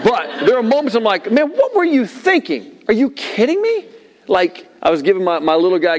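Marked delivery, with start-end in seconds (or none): 1.3-3.9 high, drawn-out exasperated disbelief; mock yell of a fed-up parent; retold yelling. Parent confronting the children, retold high-pitched yelling. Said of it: "I've yelled at my kids".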